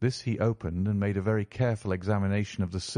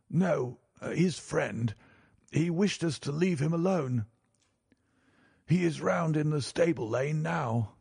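banker in a higher-pitched character voice; raised-pitch banker, matter in hand; explaining banker; 7 semitones higher than usual. A narrator's reading. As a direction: explain it to your niece as the banker. Banker answers his niece.

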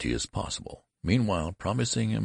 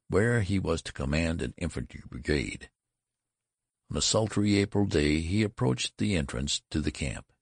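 ordinary delivery, nothing marked

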